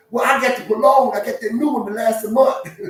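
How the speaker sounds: high, loud boastful zebra; zebra bragging. Pretend. Frank the zebra brags to the lion about his cologne, high and loud. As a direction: brag about your cologne.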